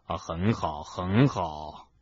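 low, soft voice putting on cheer; feigned cheer. A narrator's reading. Hagrid, in pain, reassures Harry, feigning cheer. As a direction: pretend all is fine, low and subdued. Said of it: "海格假装快活地说".

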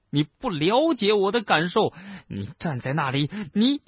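high, agitated angry outburst; angry roar. A narrator's reading. Harry rages at his headmaster, high and agitated. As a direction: lash out, voice pitched high. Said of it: "但是怒吼解决不了问题".

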